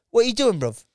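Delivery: put-on Jamaican English geezer; Jamaican English accent. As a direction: accost someone in Jamaican-London slang accent. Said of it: "that really cool accent".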